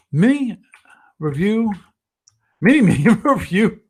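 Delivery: high, playful announcement; playful segment jingle. Pretend. Host naming the week's segment to viewers, playfully high. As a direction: pitch it up like a silly segment title.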